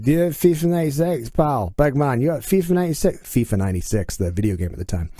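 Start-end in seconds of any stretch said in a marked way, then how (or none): 0.0-3.2 put-on higher-pitched Glasgow accent on the phone; Glaswegian caller. Caller asking shop for a game, Glaswegian accent. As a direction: imitate a Glaswegian bloke asking, raised voice. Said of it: "guy on the other end".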